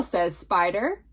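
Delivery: usual speaking voice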